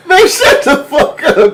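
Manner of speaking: high, slow laugh through the words; laughing disbelief. Real, not acted. Cohost reacting to the story, laughing hard. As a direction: crack up in disbelief.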